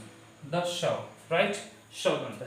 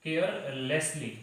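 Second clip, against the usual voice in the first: carelessly drawn out very slowly for students to write; slow dictation; much slower than usual. Shown as a demonstration. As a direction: stretch each syllable of carelessly. (carelessly को धीरे-धीरे लिखवाने के अंदाज़ में)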